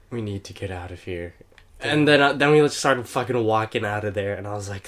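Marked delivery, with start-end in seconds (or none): 0.1-1.3 low, soft, worn-out retold plea; imitated breathless plea. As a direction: murmur it weakly after a gasp, imitating yourself. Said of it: "that's what I sounded. I was like".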